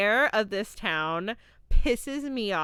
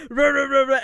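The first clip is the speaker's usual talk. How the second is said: macho rah rah; 4 semitones higher, 8 dB louder, faster than usual. Retold or imitated. Imitating macho ranting.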